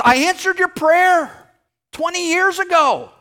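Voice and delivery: exasperated God voice. Retold or imitated. God answering the praying believer, hammed-up exasperation. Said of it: "He said".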